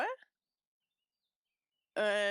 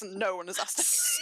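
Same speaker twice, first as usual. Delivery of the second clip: ordinary delivery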